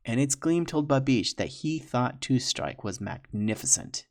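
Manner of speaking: usual speaking voice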